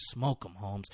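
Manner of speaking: soft, low calm order. Retold or imitated. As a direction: imitate an unbothered killer, quietly. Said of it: "He is so calm".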